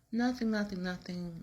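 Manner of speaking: high, quick brush-off; insistent denial. Retold or imitated. Wife denies anything is wrong.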